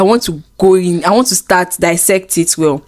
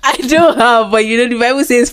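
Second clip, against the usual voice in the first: laughing; 4 semitones higher than usual. Real, not acted. Both laughing at teasing.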